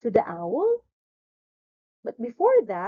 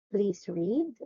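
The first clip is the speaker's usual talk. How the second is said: ordinary delivery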